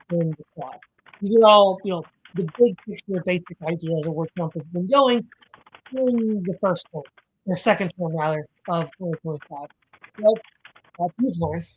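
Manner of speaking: muffled, like a hand over the mouth. Real, not acted. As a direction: speak through a covered mouth. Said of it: "you sound a little bit muffled there".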